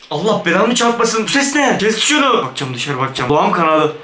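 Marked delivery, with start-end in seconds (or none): none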